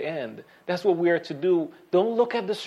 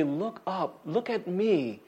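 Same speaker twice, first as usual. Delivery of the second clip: tender reassurance; 5 dB quieter, slower than usual. Retold or imitated. God lifting up our head.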